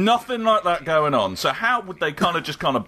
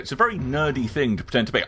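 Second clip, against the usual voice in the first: usual speaking voice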